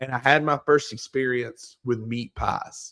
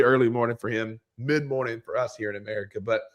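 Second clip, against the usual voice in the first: ordinary talk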